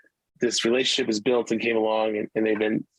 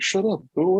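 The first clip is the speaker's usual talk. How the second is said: high, playful shut up; mock defensive snap; 9 semitones higher than usual. Dad brushing off his son while fighting tears, mock defensive. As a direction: brush the kid off in pretend denial. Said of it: "I'm like".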